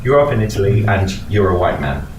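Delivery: usual speaking voice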